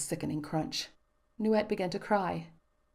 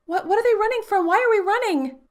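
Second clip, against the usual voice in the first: high, loud stammering fear; frightened child; 11 dB louder than usual. A narrator's reading. Daughter begs father to explain while fleeing, frightened. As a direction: ask in breathless panic.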